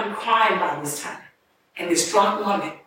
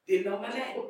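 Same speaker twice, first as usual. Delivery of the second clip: normal talking voice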